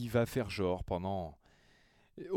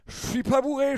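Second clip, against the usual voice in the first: put-on high, insistent drunk denial; trying to sound sober; 13 semitones higher, 8 dB louder than usual. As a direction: protest you're sober, too eagerly. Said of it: "quand t'es là en mode".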